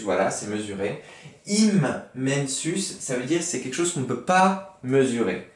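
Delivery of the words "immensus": Immensus drawn out very slowly, m held; slow careful Latin. Shown as a demonstration. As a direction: stretch the Latin word for the class. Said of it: "teacher repeating Latin word".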